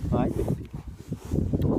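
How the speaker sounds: hushed cooing. Real, not acted. Cooing to horse.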